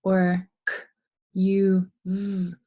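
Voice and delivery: k, u, z segmented, z buzzed; sounded out with Z. Shown as a demonstration. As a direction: sound out each part, the z voiced.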